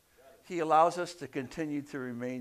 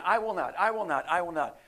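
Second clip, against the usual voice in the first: "I will not" hit louder, pitch raised; quick, insistent stress on each "I will not"; emphatic; 4 semitones higher, 7 dB louder, faster than usual. Acted. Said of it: "that's an emphatic point".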